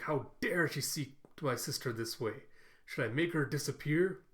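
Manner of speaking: ordinary talk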